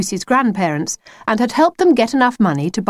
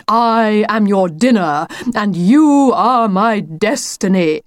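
slow, drawn-out mock menace; theatrical declaiming; slower than usual. The wolf shows his planned pounce line, grandly declaimed.

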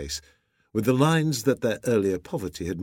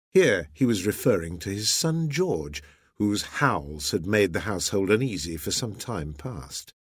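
plain reading voice

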